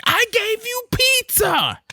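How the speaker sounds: very high, slow drawn-out wail; wailing kid. Retold or imitated. Kid crying out to his dad, wailing. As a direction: stretch it out like a child's wail. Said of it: "It's like".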